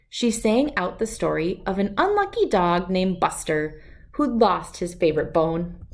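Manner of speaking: plain reading voice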